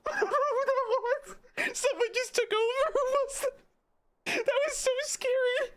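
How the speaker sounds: laughing through fear, pitch way up; words squeezed out between laughs; shaky high laughter. Real, not acted. Gamer still spooked by a voice, speaking while laughing.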